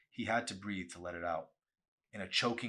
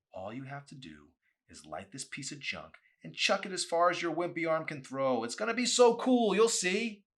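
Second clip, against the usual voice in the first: rising to a much higher, louder eager taunt; goading boy; 10 semitones higher, 11 dB louder than usual. Acted. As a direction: egg him on, getting more excited. Danny goading him to light it.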